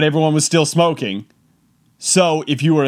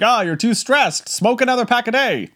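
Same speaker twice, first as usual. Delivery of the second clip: higher, breezy mock doctor advice; lightly voiced doctor, higher; 8 semitones higher than usual. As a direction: play an old-timey doctor casually prescribing. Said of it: "doctors would be like".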